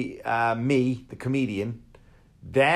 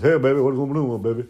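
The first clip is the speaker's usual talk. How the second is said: louder put-on [name] croon; 2 semitones higher, 4 dB louder than usual. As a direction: purr it like the King greeting a fan. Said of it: "and he was".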